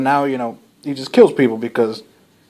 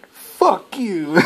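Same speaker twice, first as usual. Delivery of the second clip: mock crowd retort; 6 semitones higher than usual. Crowd brushing off the merchant.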